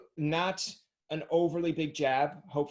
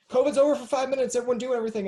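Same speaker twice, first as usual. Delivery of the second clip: louder, higher, rapid excited proclamation; mock frantic announcement; 10 semitones higher, 7 dB louder, much faster than usual. Host mimics a five-minute covid break announcement, frantic. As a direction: call it out in a mock-urgent rush.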